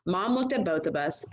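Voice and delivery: plain reading voice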